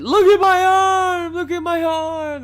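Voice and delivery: very high, drawn-out scream. Retold or imitated. Injured passenger distracting the cop, screaming. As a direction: scream it slowly, stretching each cry. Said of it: "I'm screaming about my arm".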